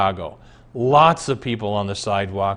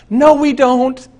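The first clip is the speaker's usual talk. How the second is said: high, loud, slow flat denial; emphatic no; 13 semitones higher, 8 dB louder, slower than usual. Real, not acted. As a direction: slam the door on that idea. Emphatic rebuttal in sermon.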